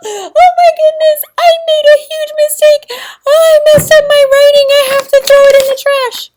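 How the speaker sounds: high, loud, hammed-up panicked wail; mock tantrum. Shown as a demonstration. Teacher acting out a tantrum for the class, loud and high. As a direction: overact a child melting down over a mistake. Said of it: "throw a tantrum and go".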